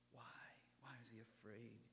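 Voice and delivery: hushed whisper; softly whispered why. Real, not acted. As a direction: lean in and whisper the question. Preacher drops to whisper.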